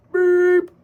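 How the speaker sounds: short high mock beep; imitated beep. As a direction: chirp it like an answering machine. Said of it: "after the beep".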